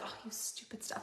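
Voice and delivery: breathy whisper; whispered mock scold; whispered fond scold. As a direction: hiss it fondly at the product. Retold fond complaint, whispered.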